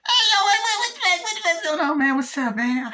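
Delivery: excited high-pitched homie voice; squeaky actor impression. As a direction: greet your buddy like a cartoonish hustler. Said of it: "doing the impressions of the, uh, actors".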